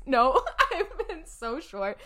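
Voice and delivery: laughing through the words. Real, not acted. Telling her cohost about her curt texts, laughing.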